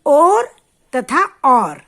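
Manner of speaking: 'और तथा और' said slowly, each word set apart; slow, careful teacher's contrast. Shown as a demonstration. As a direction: spell out 'और' and 'और' slowly for the class. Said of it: "तो बच्चे देखिए".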